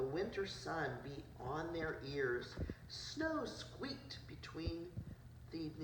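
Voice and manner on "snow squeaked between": ordinary delivery, nothing marked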